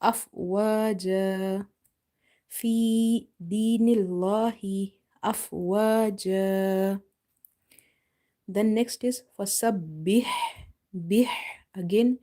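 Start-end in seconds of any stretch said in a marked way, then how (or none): 0.0-7.0 afwaja slowly chanted with a long final a; recited pause form. Shown as a demonstration. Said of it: "we will read afwaja". 10.9-11.3 Bih with a breathy ha from mid-throat; throaty ha. Shown as a demonstration. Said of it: "pronounce the ha from the middle of your throat".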